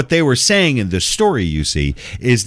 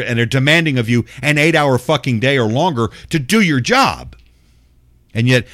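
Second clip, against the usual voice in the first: normal talking voice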